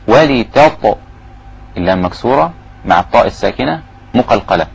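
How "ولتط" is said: ولتط ending in a bouncing echo on the ط; qalqala on ta. Shown as a demonstration. Teacher demonstrates qalqala of ط in ولتط. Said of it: "إذن أقلقل الطاء".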